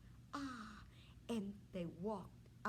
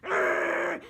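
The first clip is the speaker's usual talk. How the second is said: loud hammed-up growl; a princess growling at the king; angry growl; 8 semitones higher, 15 dB louder than usual. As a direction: growl like a cartoon grump. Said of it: "turned to the king with a terrible temper".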